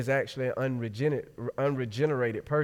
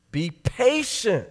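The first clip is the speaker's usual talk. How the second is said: higher, slow pleading; begging servant; 7 semitones higher, 5 dB louder, much slower than usual. Acted. Servant on his knees pleading "Be patient" with the master, slow and high. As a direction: stretch out "Be patient" as a desperate plea, higher and slow. Sound like the same phrase.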